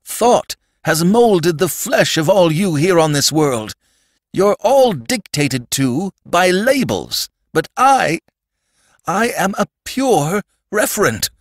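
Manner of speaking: high, impassioned declamation; frantic Sandman. Acted. Sandman explaining labels to the boy, frantically insistent. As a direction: proclaim it desperately, leaning on key words.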